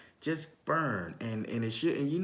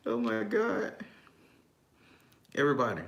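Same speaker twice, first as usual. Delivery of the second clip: usual speaking voice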